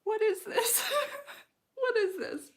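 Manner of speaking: high, loud voice breaking into tears; real tears and laughter at once; choking up, laughing through tears; crying. Real, not acted. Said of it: "I'm getting really emotional right now".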